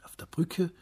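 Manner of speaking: plain reading voice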